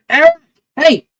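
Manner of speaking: high, loud call to a classmate; yelling out. Acted. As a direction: call out to get a friend's attention. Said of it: "you can hear [name] yell out".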